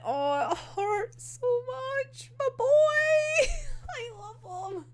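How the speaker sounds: high, slow, tearful whine; mock crying. Fan hurting for a favourite character, high mock-crying. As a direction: wail it slowly, overcome with affection.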